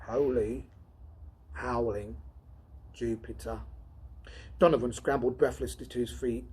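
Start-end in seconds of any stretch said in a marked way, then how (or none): 0.0-3.6 softer, awed hush; softly horrified exclamation; awed whisper. Acted. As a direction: breathe it out in horror. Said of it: "He whispered".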